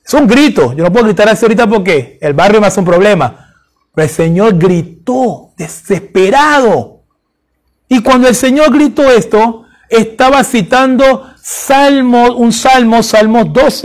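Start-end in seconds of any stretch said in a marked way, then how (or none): none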